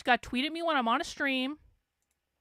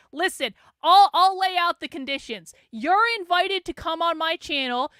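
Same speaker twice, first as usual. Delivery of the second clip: loud, raised-pitch ultimatum; firm demand; 3 semitones higher, 7 dB louder than usual. Real, not acted. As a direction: lay down terms loudly to an opponent. Laying down terms to opponent.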